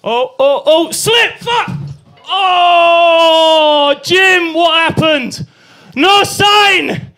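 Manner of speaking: high, loud cries of a crash; panicked yelling. Acted. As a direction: howl in pain and outrage. Acted fall on ski slope.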